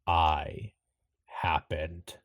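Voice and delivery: low, measured, ominous pronouncement; deep rumbling voice. Acted. Mysterious voice answers Iris's question, deep and rumbling. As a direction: let each word land slowly, low and eerie. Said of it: "The voice says".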